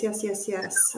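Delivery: usual speaking voice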